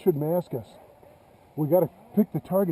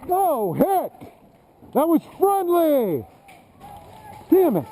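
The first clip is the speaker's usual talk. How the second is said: frustrated shout; 10 semitones higher, slower than usual. Real, not acted. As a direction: yell out in frustration. Hit by friendly fire.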